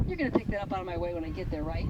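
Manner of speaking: tossing off the dog's demand, quick and low; smug dog voice. Retold or imitated. Trainer voicing the dog that expects its way, smug and fast.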